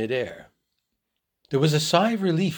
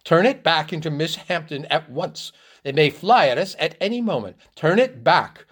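higher, urgent, mildly screamed order; shrill demand; 5 semitones higher, 4 dB louder than usual. A narrator's reading. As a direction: insist in alarm, louder at the end. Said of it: "she screamed shrilly".

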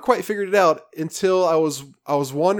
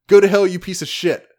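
retold yell; 4 semitones higher, 6 dB louder than usual. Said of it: "You hear somebody yell".

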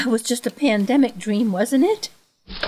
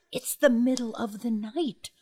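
ordinary delivery

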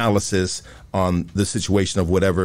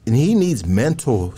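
normal talking voice

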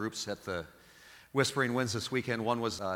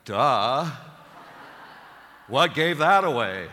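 much louder, higher sarcastic retort; sarcastic duh; 4 semitones higher, 12 dB louder, slower than usual. Retold or imitated. Priest mocking the passenger's question, loud sarcasm. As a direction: hurl the obvious back at her. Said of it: "And I'm like".

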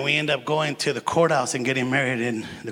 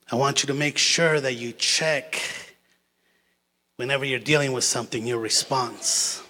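ordinary delivery

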